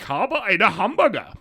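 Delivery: high, loud mock German; hammed-up German pronunciation; exaggerated German accent. Shown as a demonstration. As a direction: bark German in a stupid accent. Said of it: "putting on a stupid accent".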